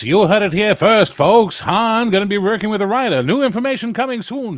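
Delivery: put-on high, loud old-time radio announcer; Mid-Atlantic radio voice. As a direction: boom it out like a Mid-Atlantic broadcaster. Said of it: "doing my Mid-Atlantic radio voice".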